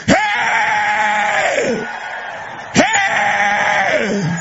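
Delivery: high, loud "Hey!" cry; shout. Real, not acted. Preacher rousing the congregation, loud high shout. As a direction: yell it out to rouse the crowd.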